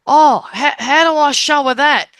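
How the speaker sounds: a hint of a puzzled spirit asking for help. Spirit puzzling how to show message.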